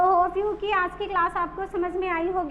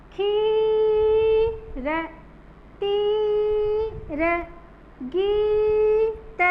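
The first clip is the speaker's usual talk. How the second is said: खीर, तीर, गीत, चील, people, Deepak with a very long held ee; long stressed ee; 3 semitones higher, much slower than usual. Shown as a demonstration. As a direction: stretch each ee slowly, almost sustained. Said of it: "लंबा लेके बोलना है या जोर देके बोलना है". (हर शब्द में ई को लंबा खींचकर)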